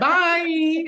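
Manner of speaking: high, laughing mock "Bye"; imitating her bye. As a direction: mimic her squeak through laughter. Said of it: "And then you see her".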